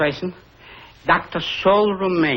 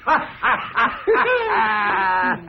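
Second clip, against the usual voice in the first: overjoyed decision to go to Dublin; joyful laughter; 5 semitones higher than usual. Acted. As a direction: burst into laughing joy. Laughing with joy.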